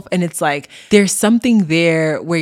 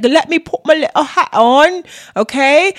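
higher, louder, a prim know-it-all lecturer; mock professor; 10 semitones higher, 5 dB louder than usual. Host mimics a fussy professor for listeners, higher, louder. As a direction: put on the professor's little hat and preen. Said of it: "kinda like the professor".

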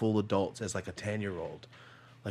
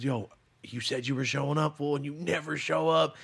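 retold complaint; 5 semitones higher, 3 dB louder than usual. Child confronting dad over missed games.

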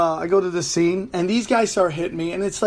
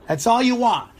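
ordinary delivery

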